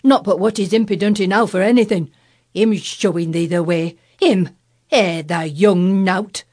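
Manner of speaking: loud, scornful Yorkshire grumbling; indignant Yorkshire gardener. A narrator's reading. Ben's indignant dialect grumbling.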